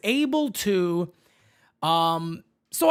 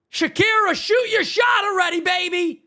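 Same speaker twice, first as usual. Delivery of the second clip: higher, louder teasing call-out; playful shout; 9 semitones higher, 3 dB louder than usual. Acted. Host teasingly calling out to [name], loud playful shout. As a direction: yell it across the room like a dare.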